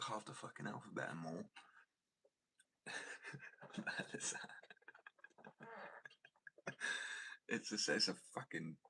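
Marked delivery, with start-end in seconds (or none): 2.9-7.4 long breathy laughing fit; wheezy burst of real laughter; helpless laughter. Real, not acted. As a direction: crack up, mostly breath with little voice. Cracks up at the long name.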